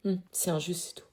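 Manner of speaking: whispering. Acted. Upset teen after adult advice.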